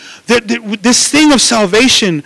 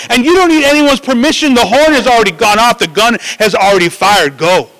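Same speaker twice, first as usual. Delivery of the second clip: usual speaking voice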